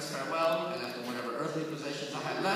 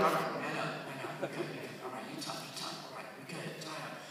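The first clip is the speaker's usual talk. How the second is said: much softer muttered self pep talk; 4 semitones lower, 9 dB quieter than usual. Retold or imitated. Boy in the doorway talks himself tough, softly. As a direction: quietly psych yourself up after crying. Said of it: "you're like".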